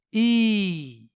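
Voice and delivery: ای held for two beats; long drawn ای; ya stretched long. Shown as a demonstration. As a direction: draw out ای. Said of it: "یا کو کھینچ کر پڑھنا".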